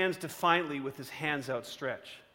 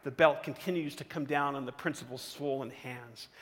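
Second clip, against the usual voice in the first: ordinary talk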